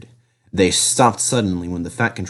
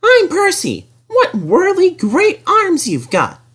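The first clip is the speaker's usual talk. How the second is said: much higher, chirpy small engine; little engine voice; slower than usual. Acted. Percy grumbles at the helicopter in his high voice.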